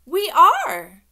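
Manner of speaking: put-on loud, high childish exclamation; eager insistence. A narrator's reading. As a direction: burst out to reassure him. Child eagerly reassures principal.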